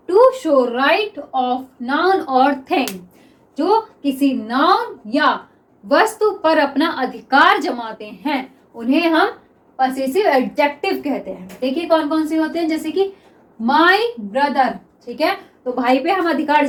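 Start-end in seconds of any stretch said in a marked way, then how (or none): 0.1-2.9 "to show right of noun or thing" read very slowly, word by word; dictation pace. Shown as a demonstration. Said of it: "teacher reads the definition". 13.6-14.7 "my brother" drawn out very slowly; slow it down so they can note it; dictation pace. Shown as a demonstration. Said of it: "teacher gives the example".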